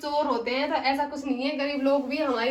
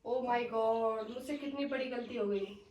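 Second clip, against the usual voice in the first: low, drawn-out regret, fading softer; shocked remorse; 5 semitones lower, 6 dB quieter, slower than usual. Acted. Employer realising she wrongly blamed maid, remorseful. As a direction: let the guilt sink in slowly.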